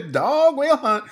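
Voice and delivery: high, loud crazed cackle and growl; leering horror villain voice; Bill Moseley impression. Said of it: "He has that very famous line where he's like".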